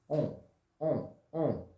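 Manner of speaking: On with rounded lips, nasal; nasal on sound. Shown as a demonstration. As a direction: pout and hum On. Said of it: "o N com biquinho".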